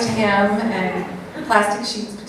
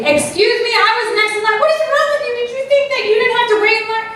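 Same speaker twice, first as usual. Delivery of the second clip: hammed-up outrage at a line-cutter; over-the-top aggressive confrontation; 12 semitones higher, faster than usual. Shown as a demonstration. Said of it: "We can be aggressive".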